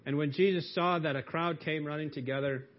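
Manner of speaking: normal talking voice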